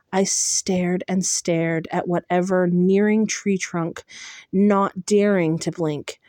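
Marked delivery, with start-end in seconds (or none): none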